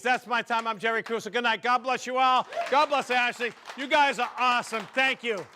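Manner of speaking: loud, high shout to the crowd; big show-closing farewell; rousing sign-off. Real, not acted. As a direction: call out your thanks over the applause. Comic's loud sign-off over applause.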